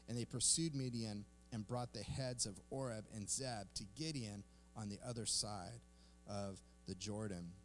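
soft, low, measured read-aloud; scripture reading. Acted. Pastor reading scripture to the congregation, quiet measured reading. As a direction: read the passage quietly and evenly.

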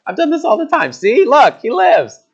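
high, loud, cocky boast; mock bravado. Prophet pretending to the mother he knew, cocky. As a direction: play it off like it was nothing. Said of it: "Almost like he's saying".

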